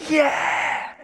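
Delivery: high, drawn-out mock cheer; crowd cheering. Preacher acting out the crowd at Niagara Falls, cheering. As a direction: imitate a roaring crowd's yell.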